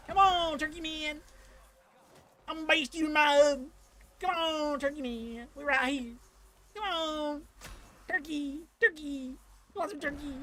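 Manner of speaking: high mocking call to the turkey boss; taunting boss voice. Gamer taunting the turkey boss, high mock voice. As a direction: egg the monster on in a high put-on voice.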